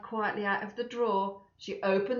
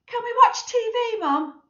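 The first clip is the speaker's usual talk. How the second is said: put-on very high, loud child voice; a child pleading for the TV; child's voice; 9 dB louder than usual. Lester to his mum, high and loud.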